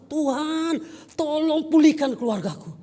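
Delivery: acted-out loud, high plea to God; loud imploring prayer, stretched words; retold desperate prayer. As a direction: cry out a drawn-out plea, imitating someone praying. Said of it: "dia minta".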